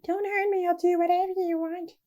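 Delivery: high-pitched, hammed-up begging; mock frightened plea. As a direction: plead up high as if fearing for your life. Acting out frightened plea from choices.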